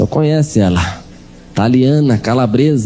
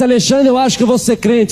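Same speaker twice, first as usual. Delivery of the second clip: a hint of his mother, pitched up; mother's voice; 9 semitones higher than usual. Preacher voices his mother telling him she'll convert, lightly raised. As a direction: lightly voice the mother announcing her plan.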